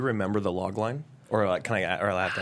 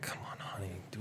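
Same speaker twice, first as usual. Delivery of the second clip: whispering; 2 semitones lower, 15 dB quieter than usual. Acted. Husband deflecting softly.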